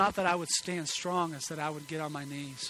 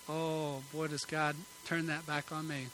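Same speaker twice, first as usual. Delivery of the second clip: ordinary delivery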